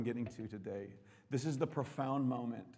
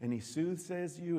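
ordinary talk, nothing marked

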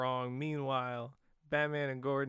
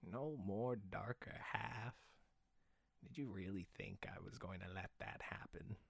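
Willem Dafoe voice; 4 semitones lower, 13 dB quieter than usual. Pretend. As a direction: purr it quietly like a menacing villain. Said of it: "I want a Willem Dafoe voice".

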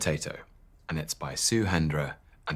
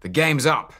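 much higher, loud triumphant yell; 9 semitones higher, 9 dB louder than usual. Acted. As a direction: yell it as the hero pounces. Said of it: "yelled Supertato".

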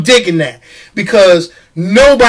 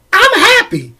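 "happy" sung out high and gleeful; high excited happy; 13 semitones higher than usual. Real, not acted. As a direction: chirp it out like it's payday.

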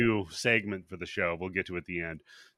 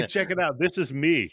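mock show-off, louder; bragging bully; 4 semitones higher, 6 dB louder than usual. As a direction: call friends over to brag. Acting bully showing off his clip.

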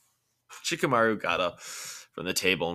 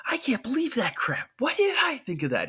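much higher, strained exasperated cry; frustrated yell. Acted. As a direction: yell it at yourself, clutching your head. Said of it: "he yelled, grabbing his head".

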